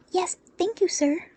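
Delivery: put-on high chorus of polite kids; quick, keen thanks from little ones; high, eager child voices; eager children. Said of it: "the others were quick to add".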